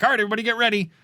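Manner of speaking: high, hyped-up announcer voice; mock a guy psyching up the room; a fan rallying the theater crowd, high and hyped. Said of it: "the one guy was like".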